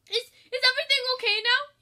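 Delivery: high, loud fretful chef; fussing. Acted. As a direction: fuss over them nervously. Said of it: "he fussed".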